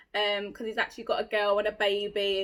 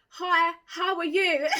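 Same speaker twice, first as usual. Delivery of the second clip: drawn-out, high mock-posh greeting; 5 semitones higher, 3 dB louder, slower than usual. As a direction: put on slow, exaggerated posh English. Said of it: "it doesn't have to be posh".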